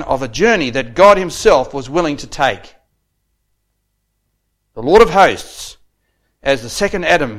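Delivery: loud, emphatic pulpit oratory; ringing sermon delivery, key words punched; declamatory preaching. Real, not acted. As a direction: drive each phrase home to the congregation. Preaches at raised force.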